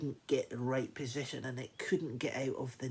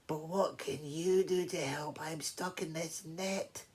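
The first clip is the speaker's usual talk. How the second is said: lightly voiced stuck lion, pitched up; a touch of helpless protest; despairing lion; 6 semitones higher than usual. Trapped lion answers.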